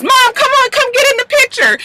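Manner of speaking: very high-pitched girlish imitation; excited daughter calling. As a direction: call Mom over in a squeaky voice. Daughter calls mom into video.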